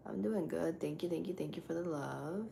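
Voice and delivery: ordinary talk, nothing marked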